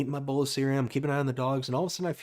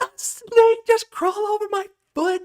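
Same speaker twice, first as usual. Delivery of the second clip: high, loud re-enacted child-like shriek; hammed-up panicked screech; blood-curdling scream; 8 dB louder than usual. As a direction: scream it like a terrified four-year-old. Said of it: "big blood-curdling scream".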